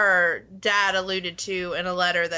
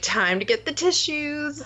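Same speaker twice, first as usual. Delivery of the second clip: mock teary warning; 7 semitones higher than usual. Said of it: "you're like".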